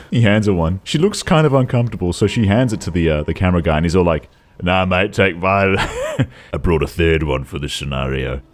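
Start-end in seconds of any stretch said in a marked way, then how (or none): 4.6-8.4 lightly put-on Aussie mate voice; low-key mock Australian; Australian accent. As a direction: offer it in a casual Australian accent. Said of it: "I don't know why I'm doing a, an Australian accent".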